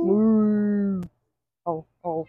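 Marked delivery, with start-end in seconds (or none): 0.0-1.0 echoed moo. Pretend. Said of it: "I don't speak cow".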